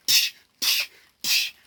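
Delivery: sharp voiceless hitting noises; hissed smack sound effects; imitated slaps. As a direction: mimic quick blows with bursts of breath.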